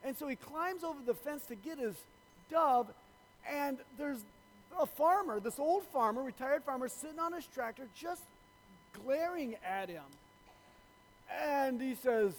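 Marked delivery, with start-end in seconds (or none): none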